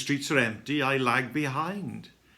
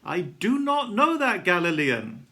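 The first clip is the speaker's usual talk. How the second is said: raised, higher-pitched protest; loud, emphatic disowning; insistent denial; 5 semitones higher, 6 dB louder than usual. A narrator's reading. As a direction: deny it forcefully, voice up. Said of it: "denying her accusation".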